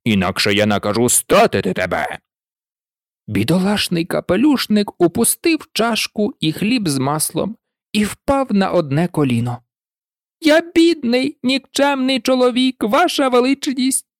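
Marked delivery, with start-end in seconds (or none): 0.0-2.2 low stern threat; a king threatening execution; lower, menacing royal warning; lightly voiced angry king. A narrator's reading. 10.4-14.0 a frightened hatter begging; higher, quavering and timid; trembling voice. A narrator's reading. Hatter pleads again.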